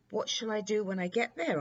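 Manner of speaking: put-on a curious young prince, fast and bright; eager prince. Prince asks daddy long legs.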